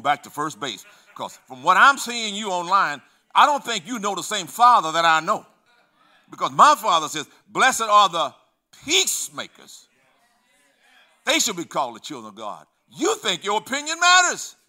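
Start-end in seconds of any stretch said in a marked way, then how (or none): 1.1-12.7 loud, high, building sermon voice; preaching at full force. Acted. Preacher warning church against online quarrels, loud and high. 12.9-14.6 high, loud, laughing ridicule; mocking scorn. Preacher mocking a Starbucks poster, laughing and loud. Mocking the online opinion-giver.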